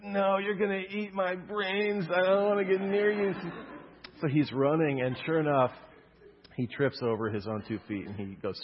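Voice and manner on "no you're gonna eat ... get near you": put-on scared little kid. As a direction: voice a frightened toddler. Scared kid voiced in story.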